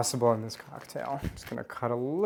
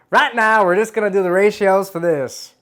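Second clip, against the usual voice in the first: put-on higher, louder Southern belle accent; 6 semitones higher, 8 dB louder than usual. Host showing a cocktail recipe, in a Southern belle accent. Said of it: "Do I sound like a beautiful Southern belle?".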